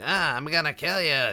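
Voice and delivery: very high, loud little gnome squawk; little people voice. Retold or imitated. Friend's gnome barbarian threatens a foe, high and loud. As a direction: imitate a tiny barbarian's high battle threat. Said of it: "little people voice, I guess".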